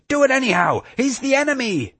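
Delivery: very high, shrieky insistence; frantic high-pitched yell; screamed command. A narrator's reading. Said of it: "Ashley screamed".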